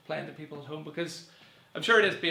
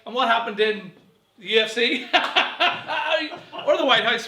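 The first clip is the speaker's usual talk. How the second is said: louder, higher mock question; mock wife voice; 7 semitones higher, 11 dB louder than usual. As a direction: play the curious wife. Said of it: "and he'd be like".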